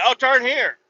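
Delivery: high, loud lady's voice; sudden bright directions from the passenger; aunt's voice. Retold or imitated. The aunt tells the driver where to turn, high loud voice.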